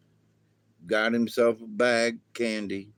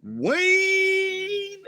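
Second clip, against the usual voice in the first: high holler. Acted. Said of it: "and say".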